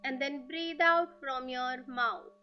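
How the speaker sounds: very slow, drawn-out breathing cues; guided breathing meditation. Acted. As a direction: guide them through each breath, stretching the words. (धीमी, लंबी खिंची ध्यान की आवाज़)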